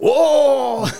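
a loud, high, shocked "Oh!" from the whole courtroom; crowd gasp. Retold or imitated. The courtroom crowd reacts to the accused, loud shocked gasp. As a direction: gasp like a scandalized audience. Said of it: "tout le monde".

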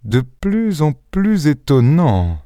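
slow, deliberate articulation; articulating carefully. Acted. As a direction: spell out each word slowly. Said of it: "articula Lupin".

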